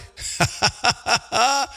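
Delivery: real rolling chuckle; hearty chuckle. Real, not acted. Host hears his pal poured Wild Turkey, hearty chuckle. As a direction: laugh with relish at the answer. Delighted at the bourbon answer.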